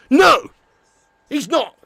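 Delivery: ordinary talk, nothing marked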